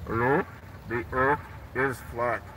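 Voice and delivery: normal talking voice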